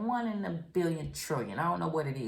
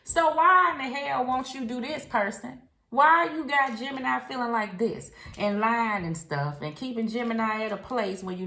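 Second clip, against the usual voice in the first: louder, pushing hard at the partner; frustrated scolding; 6 semitones higher, 8 dB louder than usual. Real, not acted. Tarot reader scolding the absent partner, raised voice. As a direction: call them out.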